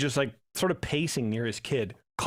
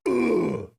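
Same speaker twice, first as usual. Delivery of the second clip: a heavy chesty cough sound; deep cough imitation; 7 semitones higher, 4 dB louder than usual. Dad next to him coughing like a bass drum. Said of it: "just going".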